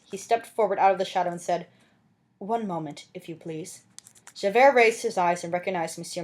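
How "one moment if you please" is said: softer, quiet authority; calm interruption. Acted. The mayor halting the soldiers, quiet and calm. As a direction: step in quietly.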